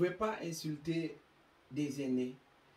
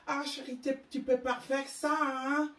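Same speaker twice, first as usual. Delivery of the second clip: higher, affected Europeanized mother voice; mock pampering mother; 9 semitones higher, 4 dB louder than usual. Wealthy mother coaxing her child, mocked with higher affected tone. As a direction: mimic a rich mother's put-on sweetness. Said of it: "Ils veulent que".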